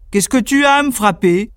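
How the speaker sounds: gruff complaint, hammed a bit; grumpy giant. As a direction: woken up and annoyed. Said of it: "lui dit".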